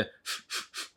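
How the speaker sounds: soft voiceless "フッ" puffs through teeth and lip. Shown as a demonstration. Teacher showing how to form F, soft airy bursts. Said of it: "下の唇を前の歯に当てて".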